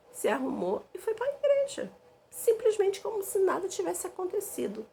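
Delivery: high, incredulous sarcastic tone; mock how he just strolled off to church; indignant sarcasm. Real, not acted. Indignant at his church-going.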